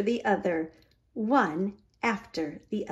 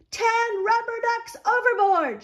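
captain's shout; 13 semitones higher than usual. A narrator's reading. Captain shouting to the crew about lost ducks. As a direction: shout the alarm across the deck. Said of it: "shouts the captain".

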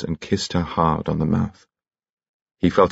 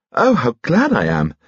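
excited; 7 semitones higher, 4 dB louder than usual. Acted. Delighted exclamation over tea.

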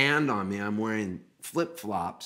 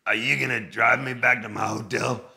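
put-on singer's voice, louder; 5 dB louder than usual. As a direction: imitate the wary passenger, pointedly loud. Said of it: "And then he goes".

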